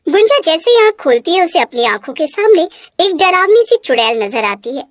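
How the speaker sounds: plain reading voice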